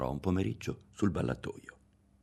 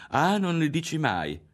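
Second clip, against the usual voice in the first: mocking; 10 semitones higher, 4 dB louder than usual. A narrator's reading. Girl mocking the boy's denial.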